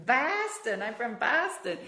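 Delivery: Boston accent. Shown as a demonstration. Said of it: "that Massachusetts accent".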